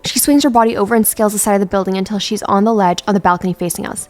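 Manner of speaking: ordinary talk, nothing marked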